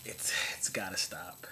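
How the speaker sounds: hushed plea to make it stop; whispered plea. Real, not acted. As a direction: beg it under your breath. Pleading about Chuck Norris.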